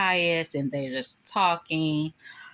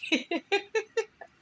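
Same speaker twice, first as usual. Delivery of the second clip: high giggle. Real, not acted. Laughing at Marva.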